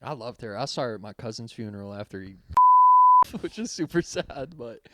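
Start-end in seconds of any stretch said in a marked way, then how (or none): 2.4-3.3 real high, loud laugh that hurts; pained laugh. Real, not acted. Recalling a cousin's funeral to friends, pained high laugh. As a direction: burst out laughing through the hurt. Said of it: "I'm laughing 'cause it hurts".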